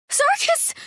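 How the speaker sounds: very high alarmed cry; cry of his name. A narrator's reading. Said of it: "cried Halla".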